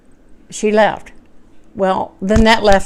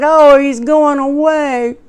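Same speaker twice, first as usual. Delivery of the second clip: put-on sad child; 6 semitones higher, 4 dB louder, slower than usual. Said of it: "then he got sad".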